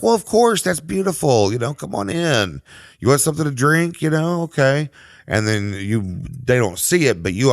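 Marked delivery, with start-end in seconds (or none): 0.0-4.9 higher-pitched, gushing welcome, easing off; welcoming homeowner. Acted. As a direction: act out an obliging homeowner inviting someone in. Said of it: "And they're like".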